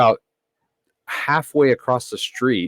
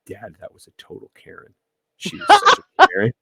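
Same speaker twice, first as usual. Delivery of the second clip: imitating his son; 4 dB louder than usual. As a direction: mimic the son giggling about it. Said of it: "my son was like".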